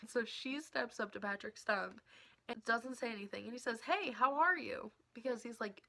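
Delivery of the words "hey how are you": high, loud, slow sing-song hello; imitating friendly greeting. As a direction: greet like the nicest dude on the planet. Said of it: "the nicest dude on the entire planet".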